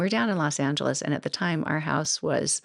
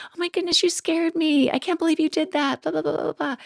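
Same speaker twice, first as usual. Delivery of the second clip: high squealing scold, a bit louder; high-pitched half-joking telling-off; joking startled scolding; 14 semitones higher, 3 dB louder than usual. Retold or imitated. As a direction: pour out mock outrage in a shrieky rush. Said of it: "but more joking".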